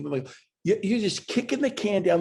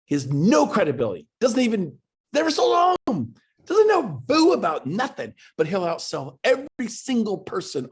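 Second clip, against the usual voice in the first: louder, climbing higher with each phrase; rising rant; 6 semitones higher, 4 dB louder than usual. Real, not acted. Coach pushing agents on the webinar, loud and rising. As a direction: build into an emphatic rant.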